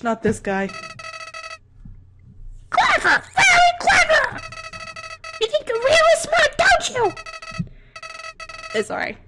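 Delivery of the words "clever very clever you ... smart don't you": much higher, louder mocking flower shout; Flowey taunt. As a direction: jeer at the player like a nasty little flower.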